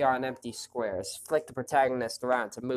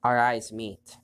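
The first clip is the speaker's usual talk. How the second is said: usual speaking voice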